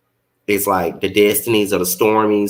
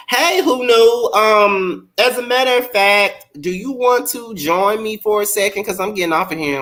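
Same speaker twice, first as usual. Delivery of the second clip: excited greeting; 12 semitones higher than usual. Real, not acted. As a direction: greet a friend with delight. Greeting guest on live.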